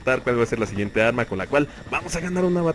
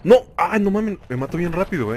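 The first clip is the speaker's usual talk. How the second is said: startled outburst; 6 semitones higher, 3 dB louder than usual. Real, not acted. Killed fast in game.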